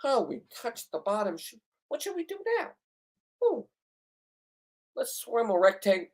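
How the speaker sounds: high, soft mocking voice of a timid diver. Mocking casual divers to his viewers, high and soft. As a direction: mimic the timid divers with soft mock awe. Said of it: "not your".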